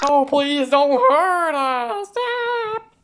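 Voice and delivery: high, drawn-out wail for mercy; stretched panicky shriek; terrified pleading. Acted. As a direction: beg loudly as if under attack. Begs an attacker for mercy.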